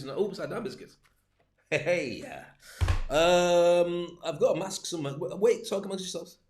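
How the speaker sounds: normal talking voice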